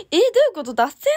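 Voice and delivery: loud, high cry of alarm; shocked. Real, not acted. Idol hearing a fan's train derailed, shocked. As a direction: react in real shock.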